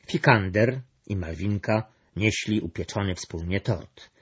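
ordinary delivery, nothing marked

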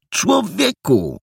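high, drawn-out reproachful cry; indignant exclamation. A narrator's reading. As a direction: lay into him with it. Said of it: "natarł na niego Perełka".

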